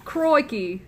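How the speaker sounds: loud, high Aussie exclamation; Australian accent. Shown as a demonstration. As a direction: bark it out like an Aussie. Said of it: "learn how to do other accents".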